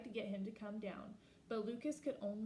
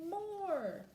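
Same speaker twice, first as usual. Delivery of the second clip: shouted high and loud, hungry for more; eager shout; 9 semitones higher, 5 dB louder than usual. Acted. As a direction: yell it like a greedy kid wanting more. Boy demands more books.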